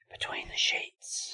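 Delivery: breathy whispered segment name; whispered title. Shown as a demonstration. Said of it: "welcome to a very new segment".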